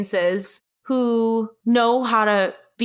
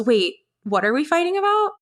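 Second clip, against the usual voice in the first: high, mock-clueless wide-eyed question; mock innocent; 7 semitones higher than usual. Imitating her boyfriend feigning confusion, high and innocent. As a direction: play dumb like you forgot everything. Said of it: "It's like he Googled how to gaslight someone".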